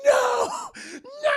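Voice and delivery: high, loud hammed-up wail; wailing protest. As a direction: cry out in mock anguish. Happy laughed at, cries out.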